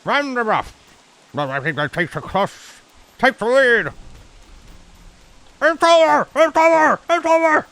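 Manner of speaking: high hyped-up fight announcer voice; Jazzy fighting game announcer. Retold or imitated. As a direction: yell it like an arena announcer calling the knockout. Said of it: "has to be voiced by Jazzy".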